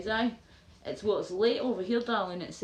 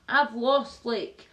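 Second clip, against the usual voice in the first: usual speaking voice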